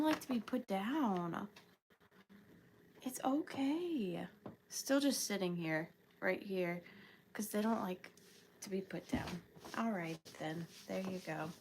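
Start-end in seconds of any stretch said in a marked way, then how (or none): 3.0-4.3 soft slow unvoiced whisper to the rabbit. Real, not acted. Soothing the rabbit on her lap, slow whisper. 4.7-11.5 low, soft words to a nervous pet; soft reassurance. Real, not acted. Mom soothes the rabbit she won't put down, hushed.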